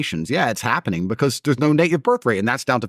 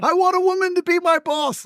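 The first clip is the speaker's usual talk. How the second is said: high-pitched mocking simp voice; mocking effeminate voice. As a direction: sneer it out in a high mock whine. Mocking men wanting female boss.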